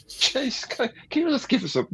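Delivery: high, fast pleading whine, then fading; exasperated soldier plea. Retold or imitated. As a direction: beg for a break, worn out. Soldiers pleading under arrow fire.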